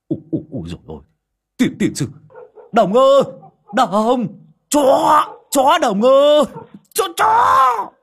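panicked high yells building to a cry; terrified shouting. A narrator's reading. Old man Vó, scared of the dog, shrieks for his friend. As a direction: scream for help as the dog lunges.

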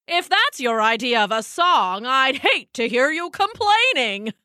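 smug bird, sneering; sneering lark. Acted. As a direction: sneer at her song.

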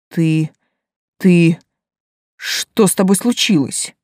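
thin, broken, stammering voice; words breaking off in fright; тонкий прерывистый голос. Acted. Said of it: "Голос Вадима внезапно стал тонким и прерывистым". (сбивчиво, испуганно, с запинками)

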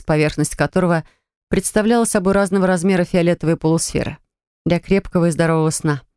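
usual speaking voice

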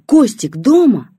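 очень высоко и громко, медленно; выкрикни, растягивая слова; крик. A narrator's reading. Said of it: "крикнула бабушка". (бабушка кричит на весь двор: громкий протяжный крик)

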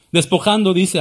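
ordinary delivery

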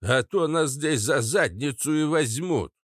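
put-on querulous old-man voice; Papasha Katz. A narrator's reading. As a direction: grumble a creaky warning. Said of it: "проскрипел папаша Кац".

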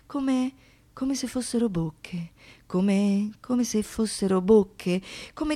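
ordinary talk

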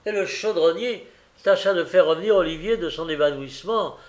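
ordinary delivery

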